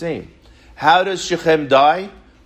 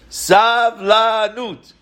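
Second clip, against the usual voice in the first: "Savlanut" stretched very slowly on a raised pitch; drawn-out key word; 7 semitones higher, 3 dB louder, much slower than usual. Real, not acted. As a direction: announce "Savlanut" high, lingering on every syllable. Reveals the secret word, teaching it.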